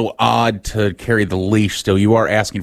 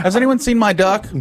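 put-on naive kid; 10 semitones higher than usual. Joke: kid asks about duck.

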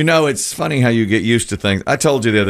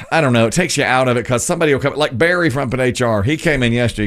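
usual speaking voice

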